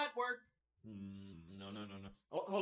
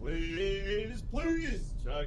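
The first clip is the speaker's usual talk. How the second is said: slow pleading; 6 semitones lower, much slower than usual. Acted. Begging slowly.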